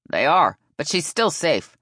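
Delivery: loud, emphatic retort; loud, firm last word; yelled comeback. A narrator's reading. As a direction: shout back, refusing to budge. Umpire yells back.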